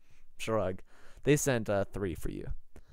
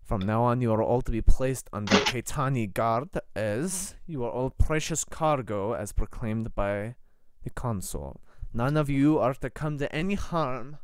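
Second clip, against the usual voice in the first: put-on formal, measured Russian-accented prelate. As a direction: Eastern European guard official laying down rules. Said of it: "at the Russian accent".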